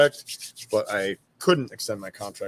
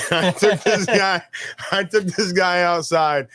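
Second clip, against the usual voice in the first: real laugh, higher and slower, through the words; 8 semitones higher, 4 dB louder than usual. Real, not acted. Veteran telling the interviewer his story, laughing through it. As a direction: laugh as you start the punchline.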